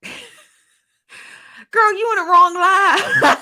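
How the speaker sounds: high, laughing through the words; real laughter breaking out. Real, not acted. Vlogger laughs at a viewer comment, laughing high.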